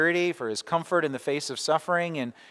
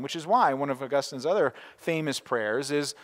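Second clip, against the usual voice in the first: usual speaking voice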